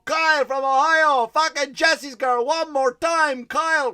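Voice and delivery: high, loud, slow karaoke host call; roaring announcer hype; hyped host shout. Retold or imitated. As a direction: bellow it like a pumped-up MC. Host hyping the crowd.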